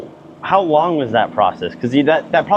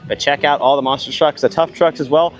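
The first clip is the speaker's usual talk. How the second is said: upbeat local news promo read; event promo read; 4 semitones higher than usual. Acted. Reporter reads event promo.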